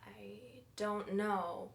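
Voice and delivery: slow guilty whisper. Acted. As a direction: barely breathe the answer. Guilty evasive answer.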